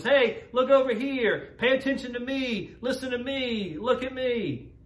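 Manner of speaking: high, insistent calls for attention; crying out. Acted. Distracting voices competing for our attention, crying out high. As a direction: call out high, each demand on its own. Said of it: "they're all crying out to us".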